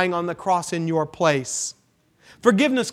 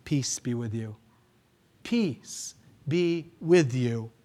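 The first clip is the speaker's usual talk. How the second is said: gentle blessing; 7 semitones lower, 7 dB quieter than usual. Retold or imitated. Jesus greets the fearful disciples, quiet and tender. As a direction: speak peace to frightened friends. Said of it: "He says".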